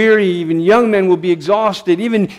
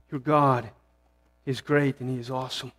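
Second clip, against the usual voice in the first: hushed and intimate; 5 semitones lower, 10 dB quieter than usual. Real, not acted. Preacher softly assuring the listeners their God is great. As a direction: drop the voice way down, almost confiding. Preacher applies verse to congregation.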